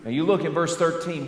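usual speaking voice

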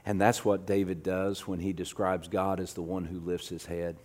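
low, very soft sermon voice; hushed preaching. Real, not acted. Preacher assuring the congregation they are not alone, hushed. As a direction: preach gently, almost under the breath.

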